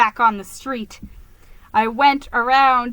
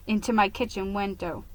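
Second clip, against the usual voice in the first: usual speaking voice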